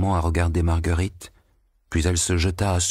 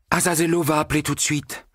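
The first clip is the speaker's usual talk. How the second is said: high, eager announcement; excited exclamation; 10 semitones higher than usual. A narrator's reading. Margarita hearing the waltz, excitedly exclaiming. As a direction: burst out with anticipation. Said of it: "s'exclama Marguerite".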